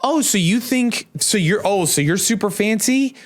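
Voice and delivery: imitating a critic, pitched high; high mock-scornful needling; mocking sneer. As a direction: put on a taunting, incredulous questioning. Mimics people sneering at reverent Catholics.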